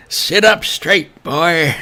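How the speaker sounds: high, loud, drawn-out scolding voice; Charles. Acted. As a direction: belt out a slow, high-pitched order in a put-on accent. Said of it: "Charles impression".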